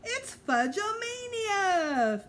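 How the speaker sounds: high, slow drawn-out shriek. A narrator's reading. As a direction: shriek it in wild delight. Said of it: "Mitzi shrieked".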